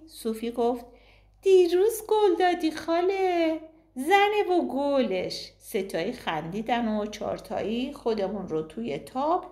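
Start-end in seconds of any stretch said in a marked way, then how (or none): 1.4-5.5 put-on high child, drawn out at the end. A narrator's reading. Little girl reminding her aunt, high and drawn out. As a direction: stretch the last words like a pleading kid.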